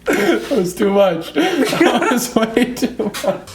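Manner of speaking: high, slow words through laughter; cracking up, barely getting it out; giggling as you speak; laughing. Real, not acted. Breaks up laughing at own bit.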